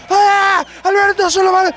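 high, loud mock crowd roar; griterío de hinchada. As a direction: drown out the speaker with fanatical cheering. Actúa público que tapa al orador. (griterío exagerado de público)

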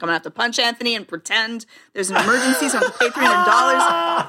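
laughing. Retold or imitated. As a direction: giggle out the scheme. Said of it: "I'm like".

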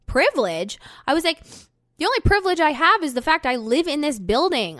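loud, high and indignant, repeating his word back; incredulous retold shout. Telling the man who yelled at her in the parkade, loud disbelief. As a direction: fire it back at him in disbelief.

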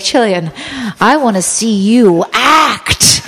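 slow, stretched-out theatrical command; hammed-up director. Director facing no audience, commanding Jillian, hammed-up and slow. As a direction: project it grandly, drawing out each word. Repeats director, exaggerated for laughs.

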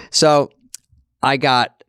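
ordinary talk

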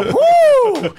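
loud, high-pitched woo; big rising holler, held long; high whoop. Retold or imitated. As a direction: whoop loudly to psych yourself up. Retells bracing for seven more minutes.

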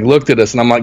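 ordinary talk, nothing marked

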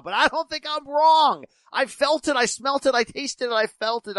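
worked-up. Real, not acted. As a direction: insist with rising intensity. Worked-up rant about being right.

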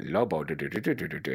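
low mock-Mexican nonsense patter; comic Mexican-accent riff on nonsense syllables. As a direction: try out a rough Mexican accent. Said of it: "Are you gonna be Mexican?".

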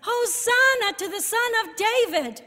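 high, loud mock chant; imitated shouting children. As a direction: call it out like cheering children. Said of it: "children singing and chanting and shouting".

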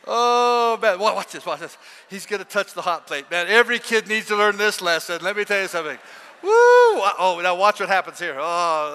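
put-on loud, excited gawking at the kids; a preacher mocking parents who enjoy watching kids get hurt; mock glee. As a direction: cheer on the disaster like a spectator. Mocking parent gleefully watching kid.

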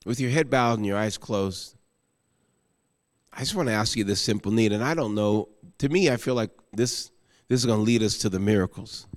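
soft, low, prayerful appeal; quiet altar call. Acted. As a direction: gently invite them to turn, voice kept low.

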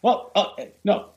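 high, flustered half-hearted protest; mock offer to pay. Guest faking a reach for the dinner tab, mock protesting. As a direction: put on a halting, high-pitched show of reaching for the bill.

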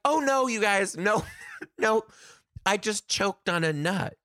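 high-pitched, half-laughing mock panic; panicked protest. Frantically explaining to the class.

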